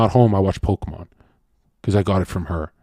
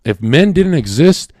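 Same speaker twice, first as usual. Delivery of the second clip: normal talking voice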